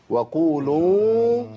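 "وقولوا" بقاف مفخمة وتدوير خفيف للشفتين; دوّر شفتيك قليلًا في "وقولوا"; rounded lips qaf. Shown as a demonstration. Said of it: "تدوير بسيطة أوي للشفتين". (تفخيم القاف في "وقولوا" بتدوير الشفتين)

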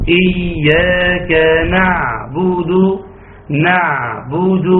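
slow, measured إياك نعبد with ع sustained in moderation; model recitation. Shown as a demonstration. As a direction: demonstrate the balanced ع of نعبد, slowly. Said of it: "اسمع كده". (إياك نعبد بترتيل متأنٍ وتوسط العين)

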